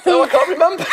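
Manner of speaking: high giggly reply; laughing. Acted. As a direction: giggle while answering. Giggling puppets.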